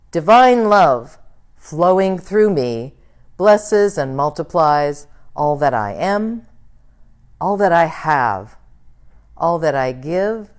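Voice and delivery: loud, slow, fervent group affirmation. Acted. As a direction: proclaim it like a shared vow. Congregation affirmation read together.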